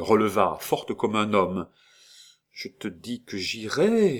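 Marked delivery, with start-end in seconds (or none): 2.5-4.2 slow, wild emotion; insist, barely holding together; distraught insistence. A narrator's reading. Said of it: "avec une émotion désordonnée". (insistance lente et bouleversée)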